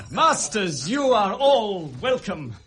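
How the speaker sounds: loud welcome. Acted. Hamlet hails the arriving players, loud welcome. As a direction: call out a big welcome across the room.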